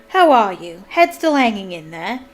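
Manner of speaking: booming, loud hearty greeting; roar. A narrator's reading. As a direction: hail an old pal heartily. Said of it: "he roared".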